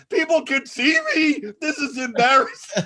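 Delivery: high, loud mock embarrassment; hammed-up flustered wail; mortified outcry. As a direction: cry out as if caught in a bathrobe. Retold lady mortified at being seen.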